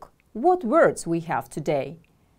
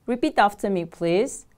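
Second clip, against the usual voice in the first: ordinary talk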